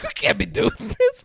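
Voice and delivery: squeaky, laughing put-on voice; a hammed-up prude pushing her off, giggling; shrill falsetto mock of a reluctant husband; mock high protest.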